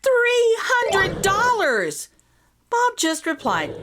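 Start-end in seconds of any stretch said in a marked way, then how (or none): 0.0-2.1 higher and very slow, stretched in disbelief; astonished exclaim. Acted. Patrick tells Bob the price, slow and astonished. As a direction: spell out the price in awe. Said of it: "Patrick exclaimed".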